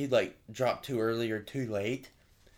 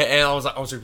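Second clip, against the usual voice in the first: ordinary delivery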